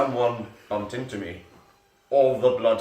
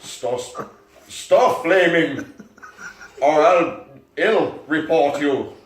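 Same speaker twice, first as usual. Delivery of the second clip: loud, slow, high mock outrage; mock indignant rant; 6 semitones higher, 8 dB louder, slower than usual. Reader playing the fic author scolding flamers. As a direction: ham up the angry author.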